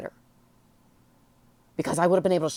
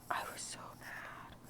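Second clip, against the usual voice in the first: breathy whispered aside; 21 dB quieter than usual. Real, not acted. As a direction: drop suddenly to a whisper. Sudden aside while retelling anger.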